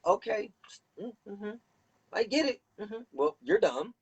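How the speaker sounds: high, condescending hum of fake listening; mocking dismissive official. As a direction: play the bored official who isn't really listening. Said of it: "looking at you like".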